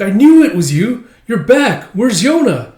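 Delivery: high, loud eager welcome; excited greeting. Acted. Girl greets the returning boy, excited, high and loud. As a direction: burst out with relief and questions.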